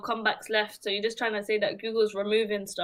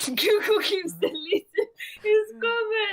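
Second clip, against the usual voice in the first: laughing; 9 semitones higher, 3 dB louder than usual. Real, not acted. Laughing while complaining.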